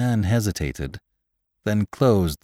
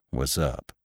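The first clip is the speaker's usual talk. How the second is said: low, soft question; second gravedigger; 6 semitones lower, 8 dB quieter than usual. A narrator's reading. Jim asking his workmate what's wrong, low-key. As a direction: ask quietly, pitched down.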